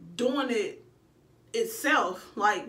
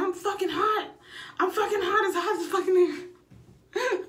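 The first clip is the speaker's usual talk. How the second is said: high, hot and fed up, half laughing; mock frustrated yell; 8 semitones higher than usual. Mother in labour complaining to the nurses, high exasperated yell. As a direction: cry out that it's too hot. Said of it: "I said".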